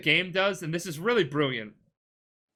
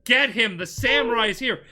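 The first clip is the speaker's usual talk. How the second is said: raised, louder mock scream; acted alarm shout; 7 semitones higher, 6 dB louder than usual. Betrayer alerting the troops to the samurai, acted shouting. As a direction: call out to everyone, retelling a scream. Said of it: "he just screams to everyone".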